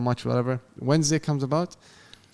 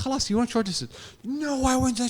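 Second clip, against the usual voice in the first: mock argument; 11 semitones higher than usual. Acting out argument with recruiter.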